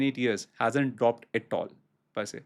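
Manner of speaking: ordinary talk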